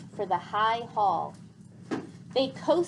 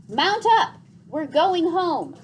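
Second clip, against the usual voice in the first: loud, high rallying shout; rally everyone for home; joyful call; 6 semitones higher, 8 dB louder than usual. A narrator's reading. Gregor rallies everyone to fly home, excited shout. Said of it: "called Gregor".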